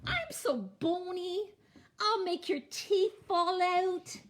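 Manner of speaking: high, loud goat voice; crying-out goat. A narrator's reading. Middle goat talking the troll out of eating him, high cry. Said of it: "cried Middle Gruff".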